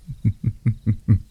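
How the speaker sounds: rolling, pleased chuckle; teasing chuckle. Acted. Demon teasing a smitten hunter, pleased chuckle. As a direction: chuckle knowingly at their desire.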